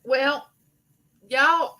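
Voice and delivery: normal talking voice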